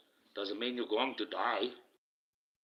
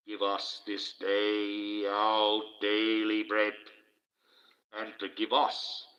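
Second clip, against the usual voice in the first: slow, level chant with drawn-out words; intoned prayer; 3 dB louder, slower than usual. Acted. Leading the chat in the Lord's Prayer, slow intoned prayer. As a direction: intone it solemnly, holding the long vowels.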